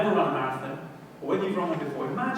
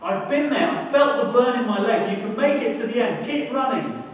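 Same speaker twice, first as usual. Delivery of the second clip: loud, high encouraging call; a champion urging you on from the roadside; Paula Radcliffe cheering; 6 semitones higher, 6 dB louder than usual. Retold or imitated. Paula Radcliffe cheers the struggling runner on. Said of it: "She says".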